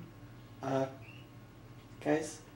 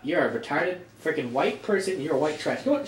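ordinary talk

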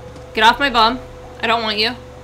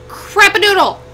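high, loud startled exclamation; excited outburst; 10 semitones higher, 8 dB louder than usual. Real, not acted. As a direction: blurt it out as the storm hits. Sudden scary storm starts.

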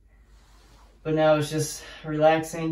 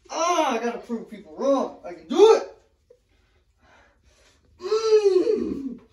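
pained laughing; 7 semitones higher than usual. Real, not acted. In the ice, laughing.

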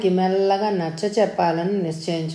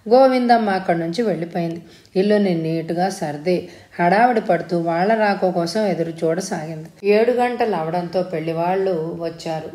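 ordinary delivery, nothing marked

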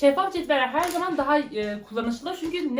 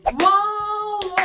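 excited wow; 6 semitones higher than usual. Real, not acted. New product revealed.

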